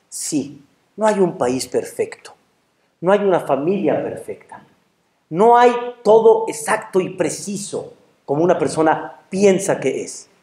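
usual speaking voice

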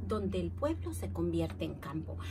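ordinary delivery, nothing marked

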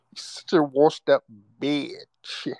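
high, slow, sassy European-accent mimicry; sassy mock European accent. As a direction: stretch it out as a sassy European caricature. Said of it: "you sound real sassy".

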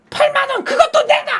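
high, loud, laughing demand; snatch the money with a shout; demanding wife. Retold or imitated. Wife demanding husband's cash, high loud shout.

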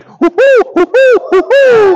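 high, loud monkey hooting; monkey hoots. Acted. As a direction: hoot like an agitated monkey. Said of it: "monkey and snake went".

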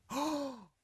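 high, drawn-out amazed oh; amazed gasp. Retold or imitated. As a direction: gasp it out in awe. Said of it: "And I was like, gasps".

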